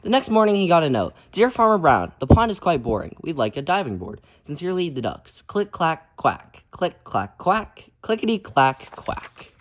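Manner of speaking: lively, loud storybook reading; reading to children. A narrator's reading. Teacher reading a picture book aloud to kids, lively. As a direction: read it out big for the kids.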